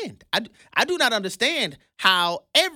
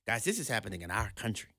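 ordinary talk, nothing marked

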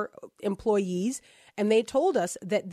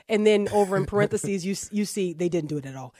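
ordinary talk, nothing marked

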